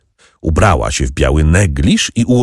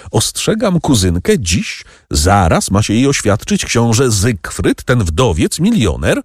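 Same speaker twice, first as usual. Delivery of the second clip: ordinary delivery, nothing marked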